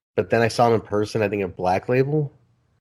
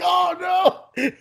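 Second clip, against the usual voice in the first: very high, wailing "oh no"; mock horrified cry. Host retelling how he saw his photo caption blunder, mock horrified. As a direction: cry out in exaggerated dismay.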